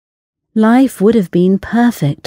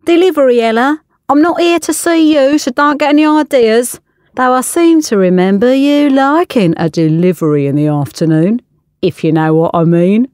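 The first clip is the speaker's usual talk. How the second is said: cocky chef; 6 semitones higher than usual. Acted. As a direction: needle her with a cheeky grin. Chef teases Ella.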